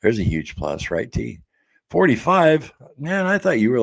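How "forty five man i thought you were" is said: much higher and louder, genuinely stunned; shocked surprise. Real, not acted. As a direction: jump up in pitch as if floored by the news. Hearing a viewer's real age.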